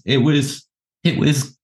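ordinary delivery

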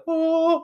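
very high, held "oh"; imitated heavenly lift. Storyteller imitating the grill going to heaven, high airy "oh". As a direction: sing out a high note of ascension.

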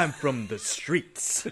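very slow, drawn-out declaration; posturing movie character. Retold or imitated. Host quotes character from the streets, slow mock posturing. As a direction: stretch it out with mock gravity.

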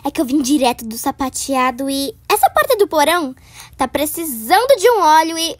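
usual speaking voice

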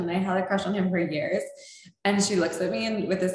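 normal talking voice

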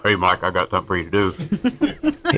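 a hint of a booming movie God, laughing through it; mock voice from the sky, not too serious; lightly voiced Hollywood God calling down with a chuckle; Charlton Heston voice. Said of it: "didn't speak like Charleston Heston from the sky".

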